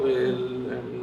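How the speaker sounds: ordinary talk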